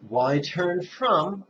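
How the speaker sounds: lifted, emphatic meditation prompt; contemplative question. Shown as a demonstration. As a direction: draw each word out over a breath. Models question for in-breath contemplation.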